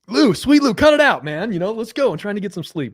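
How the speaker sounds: high, loud mock yell at a teammate; retold yell. On the night bus, yelling at Lou to stop banging: loud mock yell.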